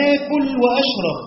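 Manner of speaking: mock speaker. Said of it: "تقول".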